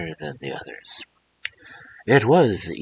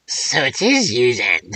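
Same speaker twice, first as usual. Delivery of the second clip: put-on old crone voice; 4 semitones higher, 7 dB louder than usual. Old woman voiced in fairy tale.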